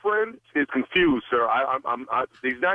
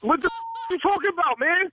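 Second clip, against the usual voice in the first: angry; 10 semitones higher than usual. Real, not acted. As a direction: yell it out in disbelief. Dispatcher blows up on caller.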